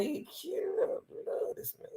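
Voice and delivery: a high whispered swoon. Pretend. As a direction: breathe it out like a secret crush. Inner thought whispered.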